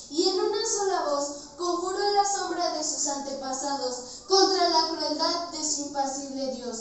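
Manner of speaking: loud, high, passionate declaiming; impassioned climax. Acted. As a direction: pour out the grief loudly. Climax: warrior curses his god.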